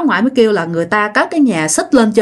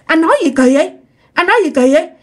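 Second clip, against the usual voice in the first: high, insistent objection; acted scandalised grandmother; alarmed protest; 6 semitones higher, faster than usual. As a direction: try to stop him with a protest. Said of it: "bà ngoại mới cản lại nói".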